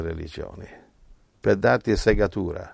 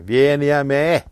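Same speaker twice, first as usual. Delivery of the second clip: higher, louder inviting call; voicing Jesus; 6 semitones higher, 6 dB louder than usual. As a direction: call them in as Jesus would. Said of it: "voicing Jesus' invitation". (Gesù che invita, voce più alta e forte)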